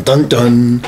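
ordinary talk

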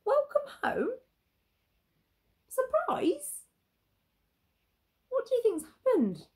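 high, baffled questioning; puzzled echo. Acted. Pirates confused by the welcome, high puzzled voice. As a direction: repeat it as if bewildered.

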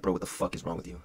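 low, soft self-disgust; hushed, disgusted talk to your own reflection; muttering at the mirror. Retold or imitated. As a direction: quietly scold yourself in the mirror.

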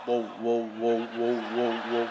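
put-on low, loud, wobbling whoa of a little boy; child's buzzing whoa. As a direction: vibrate the whoa like a happy kid on a buzzing toy. Child voiced with buzzing toy.

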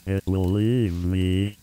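ordinary talk, nothing marked

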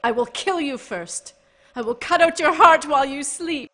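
rising into a high, loud shout; furious threat. Acted. Mergil threatening Kreia after his assault, furious shout. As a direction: scream the threat in his face.

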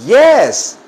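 loud, high, drawn-out answer; emphatic modelling. Shown as a demonstration. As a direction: call it out brightly for the class.

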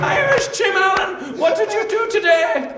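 high, loud, slow mock-Irish call; comic Irish shout; Irish Seinfeld. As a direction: holler the question like a hammed-up straight man. Irish Seinfeld questioning his co-character.

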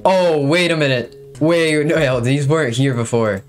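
ordinary talk

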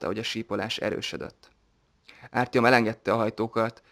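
plain reading voice

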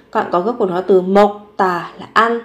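ordinary delivery

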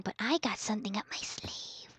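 unvoiced sly whisper; hushed sneaky aside; scheming whisper. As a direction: whisper the plan.

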